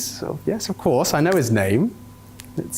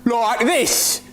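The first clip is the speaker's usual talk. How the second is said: high, exaggerated Aussie imitation; mock Australian, high and overdone; mocking Australian accent; 9 semitones higher than usual. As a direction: throw their Australian accent back at them, pitched up. Mimics guest's accent in disbelief.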